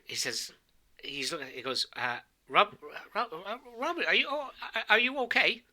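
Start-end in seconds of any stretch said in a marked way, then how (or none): 2.5-5.6 high, stammering concern; bewildered older priest. Acted. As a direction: stumble over the name in a high worried voice. Older priest stunned, asks after companion.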